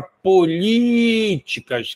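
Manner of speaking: "políticas" with "lí" held very long; stress on LI. Shown as a demonstration. Teacher showing the antepenultimate syllable, "políticas" with long stressed "lí". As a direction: stretch the "lí" of "políticas", then finish quickly. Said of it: "a sílaba mais forte tá no li".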